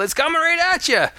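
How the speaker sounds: high, fast mock warning shout; imitated yell. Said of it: "and they kinda yelled".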